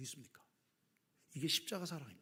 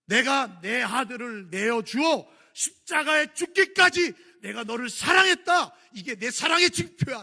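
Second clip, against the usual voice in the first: loud, higher, slow voice of God; God speaking to the people, loud; God's voice; 9 semitones higher, 15 dB louder, slower than usual. Acted. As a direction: declare it as God giving his son. Voicing God from the cross.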